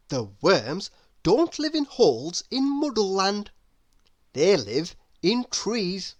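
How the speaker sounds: plain reading voice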